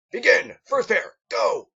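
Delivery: high, loud starting calls; shouted commands. Acted. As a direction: yell the start like a drill sergeant. Instructor starts the exam.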